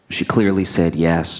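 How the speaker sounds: ordinary delivery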